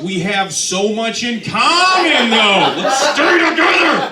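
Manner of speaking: high, loud, slow eager plea; hopeful plea. Retold or imitated. Telling the crowd how he answered his girlfriend's breakup, high loud plea. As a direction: beg her brightly to stay, half laughing. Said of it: "And I was like".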